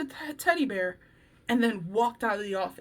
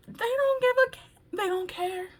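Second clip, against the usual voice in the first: high put-on careless voice; mock careless teens; 10 semitones higher than usual. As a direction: imitate kids who don't care. Mocking indifferent teens.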